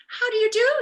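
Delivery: put-on high, loud little old lady. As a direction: greet him as a sweet old lady. Old lady greets Milo.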